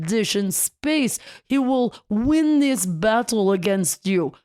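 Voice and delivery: mock Russian accent as a security guard holding him back. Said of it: "crazy Russian accent".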